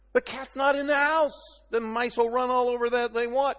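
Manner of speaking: high, bouncy telling of the mice running free; lively storytelling. Acted. Animated cat-and-mice illustration.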